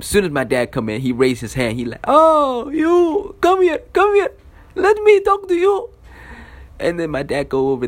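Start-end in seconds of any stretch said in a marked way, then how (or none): 2.0-5.9 very high, loud put-on teacher voice; hammed-up, high-pitched beckoning; mock teacher summons. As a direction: call someone over in a shrill caricature of a teacher. Teacher summons the dad in story.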